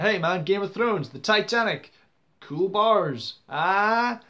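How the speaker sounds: loud, high hype-man pitch; mock enthusiasm. Country showing off attractions to visitors, mock enthusiasm. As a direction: sell it with hammed-up excitement.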